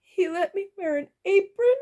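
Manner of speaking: high, loud, mournful wail; wailing goblin cook. A narrator's reading. Goblin cook grieves over her lost apron moment, wailing. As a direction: lament it in a high voice. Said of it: "Orla wailed".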